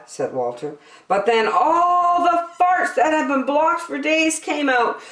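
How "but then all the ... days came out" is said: high, loud climax; building excitement. A narrator's reading. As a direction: build to the big moment. Climax narration.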